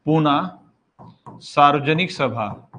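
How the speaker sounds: "Poona" then "सार्वजनिक सभा" spelled out slowly, word by word; slow dictation. Shown as a demonstration. Teacher dictating a society's name to students, slow and spaced out. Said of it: "बस इतना लिख सकते हो".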